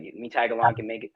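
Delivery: ordinary talk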